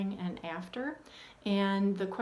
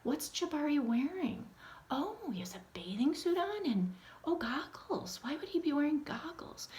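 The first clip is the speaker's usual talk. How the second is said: put-on high, lively storyteller voice to kids; bright questioning read-aloud to children; read-aloud teacher voice; 7 semitones higher than usual. Shown as a demonstration. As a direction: coax the kids into the cover picture. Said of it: "and say".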